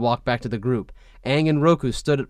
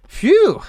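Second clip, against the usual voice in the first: relieved phew; 8 dB louder than usual. Acted. Aang relieved.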